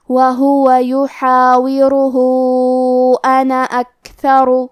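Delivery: وَهُوَ يُحَاوِرُهُ recited with the final vowel held two to four counts; long madd demo. Shown as a demonstration. Teacher models the long madd, measured recitation. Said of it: "دو سے چار مد کے برابر".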